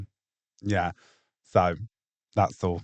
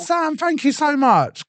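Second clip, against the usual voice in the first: excited; 14 semitones higher, 4 dB louder, slower than usual. Real, not acted. Excited thanks on a live stream.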